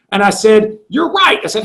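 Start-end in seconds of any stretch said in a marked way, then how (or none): 0.9-1.4 retold realization. Retold giving in to coach's point.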